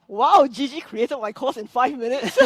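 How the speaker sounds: delighted disbelief, drawn out; amazed wow. Retold or imitated. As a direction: relive the amazement with a laugh. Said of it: "and I was like".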